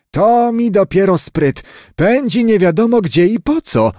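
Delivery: high-pitched scoffing retort; dismissive grandmother. A narrator's reading. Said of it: "odparła babcia i wzruszyła ramionami".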